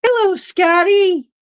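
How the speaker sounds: very high sing-out hello; playful greeting. Real, not acted. Host greeting Scotty, high playful sing-out. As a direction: call it out high-pitched.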